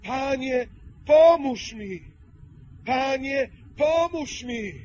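loud, high begging cry for help; błagalny, głośny krzyk; pleading voice. Acted. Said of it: "w tym błagalnym głosem mówi".

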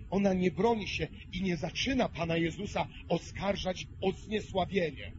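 usual speaking voice

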